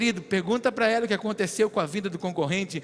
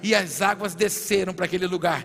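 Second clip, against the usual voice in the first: prophesy over the crowd, rising to full force; fervent preaching; 3 semitones higher, 8 dB louder than usual. Real, not acted. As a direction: build from emphatic declaration into stretched, shouted proclamation.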